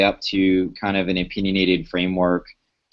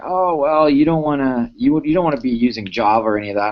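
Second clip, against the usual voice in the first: higher-pitched imitation of dismissive doubters; mock naysayer; 5 semitones higher than usual. Speaker quoting people who warn against Java, mock naysayer. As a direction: lightly mimic the people you laugh at. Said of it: "I was laughing at people saying".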